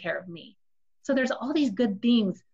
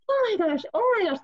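high, frantic oh my gosh; distraught panic; 13 semitones higher than usual. Shown as a demonstration. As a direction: gasp it out in panic, spiraling. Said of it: "just like that".

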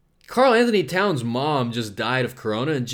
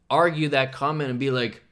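ordinary talk, nothing marked

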